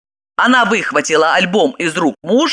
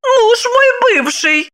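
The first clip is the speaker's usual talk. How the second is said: wife's answer; much slower than usual. Acted. Wife answers Lipochka.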